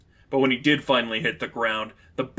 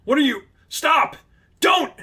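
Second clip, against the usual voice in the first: shrill rising panic, higher with each word; frantic terror; 14 semitones higher, 5 dB louder than usual. Acted. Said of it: "increasingly shaky and frantic".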